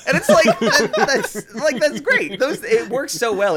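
high, laughing delight breaking through the words. Real, not acted. Friend laughing about Elnor's bluntness, high and giggly.

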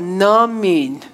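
slow, drawn-out mock amen as nah-min; joking no-amen drawn out; Cash Edward's namin. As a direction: play on amen, say it as no-amen. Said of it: "به قول کش ادوارد".

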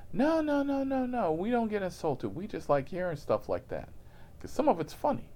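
high, eager reassuring voice; passenger voice. Retold or imitated. The passenger reassures the driver in a high eager voice. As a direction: insist brightly, high pitched.

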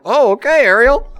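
high, bright mock surprise; amused surprise. Dad reacting to Ariel's joke, high and amused. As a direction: pitch up, delighted. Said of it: "I was like".

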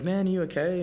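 high-pitched, lightly acted concern; retold concerned check. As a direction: lift the pitch as if checking on him. Acting out checking on crying fan.

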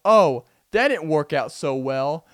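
loud, slow narrator interjection; video narrator. Retold or imitated. Narrator comments on the failed method, loud and slow. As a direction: cut in over the freeze frame. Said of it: "the narrator pop back in".

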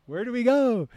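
high, loud, lost cry; confused crowd. Retold or imitated. Passengers in chaos ask where to go, high and lost. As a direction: whine like a bewildered crowd. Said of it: "everybody was just like".

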